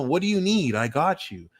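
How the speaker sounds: ordinary talk, nothing marked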